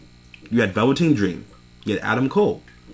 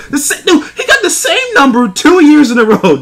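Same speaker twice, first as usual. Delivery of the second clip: much higher and louder, disbelieving; pitched-up exasperated rant; incredulous outburst; 6 dB louder than usual. Real, not acted. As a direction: squeal out the outrage at the repeat. Outraged at repeat entry number.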